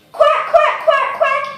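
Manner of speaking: high playful quacks; quacking duck. Pretend. Little sister shows off as a duck, high quacking. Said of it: "I'm being a duck now".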